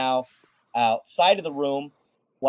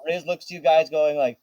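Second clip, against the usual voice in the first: ordinary talk, nothing marked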